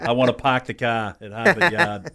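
put-on thick Boston accent, dropped Rs; a playful broad Boston accent. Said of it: "shift back to my Boston accent".

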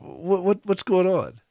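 high, halting bewildered question; embarrassed. Retold or imitated. Visitor asks the laughing pastor, embarrassed. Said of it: "getting a little bit more embarrassed".

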